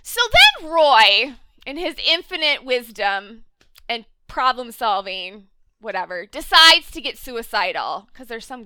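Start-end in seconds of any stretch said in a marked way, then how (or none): none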